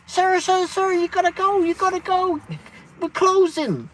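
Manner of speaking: high, loud, frantic calls of sir; imitating a panicked worker telling him to leave; urgent staff voice. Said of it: "opens up the side door and went".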